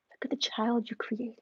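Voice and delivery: hushed whisper aside to the husband. Retold or imitated. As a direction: whisper it so the child can't hear. Aside to husband about daughter.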